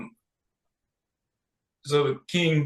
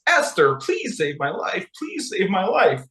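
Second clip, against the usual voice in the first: mock begging that climbs higher; desperate pleading; 7 semitones higher than usual. Condemned villain begging the queen for his life, mock-desperate. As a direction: imitate a villain pleading for mercy, lightly hammed up.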